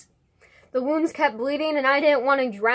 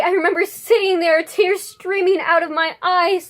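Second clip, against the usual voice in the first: raised, urgent poetic outpouring; impassioned build; 3 semitones higher, 4 dB louder than usual. Acted. Poet confesses hair loss and tears, impassioned delivery. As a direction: push higher and keep driving, as if overwhelmed. Poem builds to breaking point.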